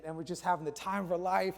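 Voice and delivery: usual speaking voice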